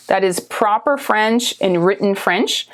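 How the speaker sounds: ordinary talk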